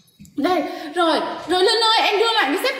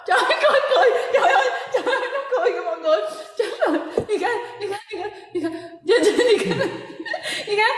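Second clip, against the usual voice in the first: giggling; 6 semitones higher, faster than usual. Real, not acted. Giggling fit at the grinning boy.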